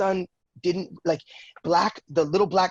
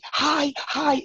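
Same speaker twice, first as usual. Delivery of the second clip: much higher, bright repeated hi; a bouncy kid greeting eagerly; high-pitched playful girl's hello; energetic little girl; 10 semitones higher than usual. Retold or imitated.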